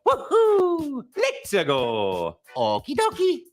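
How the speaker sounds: high, excited Italian plumber catchphrases; Mario voice. Shown as a demonstration. Mario cheering for the fans, high and loud. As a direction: bounce through the catchphrases way up high. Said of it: "I've been the voice of Mario".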